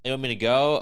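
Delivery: loud, lightly mocked macho question; imitating a dominant guy; mock machismo. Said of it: "machismo kind of way".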